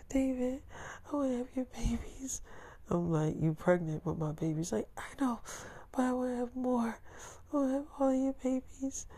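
high whispery longing voice; breathy girlfriend voice. Retold or imitated. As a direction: coo it high and hushed. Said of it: "It's like".

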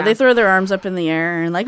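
normal talking voice

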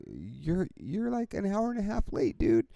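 high, exasperated "you're like an hour late"; incredulous retort. Retold or imitated. Telling off the late seat owners, high incredulous whine. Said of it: "I'm like".

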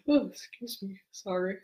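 ordinary delivery

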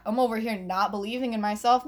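ordinary talk, nothing marked

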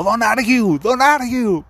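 a polite stewardess losing it, in English; flustered flight attendant. Retold or imitated. Flight attendant scolding the arguing passengers, high-pitched and flustered. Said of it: "la azafata se desbordó, pero cosa fina".

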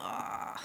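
breathy worn-out whispered ah; exhausted whispered sigh. Retold or imitated. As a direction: sigh like you're too tired to move. Mimicking exhausted lying in hotel.

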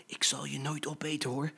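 soft, secret whisper in the ear; hushed, reassuring little cub. Acted. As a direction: whisper a promise to a friend. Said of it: "fluisterde hem in het oor".

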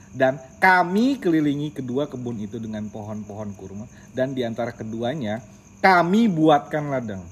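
stressing the verse phrases for meaning; recital with intonation. Shown as a demonstration. Uncle rereads the Quran verse for Acil, with marked intonation. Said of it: "Nanti Om bedain intonasinya ya".

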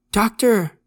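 high, loud cry for the doctor; shout. A narrator's reading. Dying kid calls for his doctor, shouting. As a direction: call out loudly, pitched well up. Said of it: "Brian shouted".

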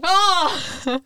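high mock scream, short and sharp; imitating a startled yell; startled yelp. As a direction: jolt awake with a yelp.

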